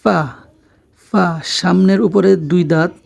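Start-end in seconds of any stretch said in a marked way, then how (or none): 0.0-1.3 ফা opened with a held f; ফা as "fffa"; lip-to-teeth F. Shown as a demonstration. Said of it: "লেগে আমাদের উচ্চারণ হবে".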